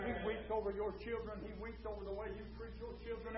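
very soft, almost under the breath; hushed preaching. Real, not acted. Preacher tells flock he weeps over families, hushed preaching. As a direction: drop right down for a solemn aside.

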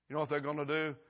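ordinary talk, nothing marked

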